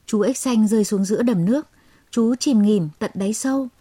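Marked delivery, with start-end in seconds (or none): none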